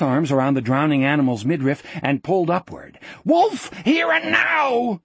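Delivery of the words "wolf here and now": very high, drawn-out screamed cry. A narrator's reading. Wolf hauling the animal up from the stream, scream. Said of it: "he screamed".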